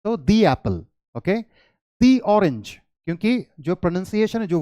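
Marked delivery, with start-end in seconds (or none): 0.2-0.8 "दी" with ee, running into "Apple"; "dee" before a vowel. Shown as a demonstration. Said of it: "इसकी pronunciation जो है". 2.0-2.8 "दी" with ee before "orange"; "dee" before a vowel. Shown as a demonstration. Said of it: "दी होगी".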